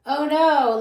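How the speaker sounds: loud, high mock-dramatic exclamation; hammed-up storybook dismay; mock alarm. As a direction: cry it out in playful alarm. Acts out adventure-book dramatic moment.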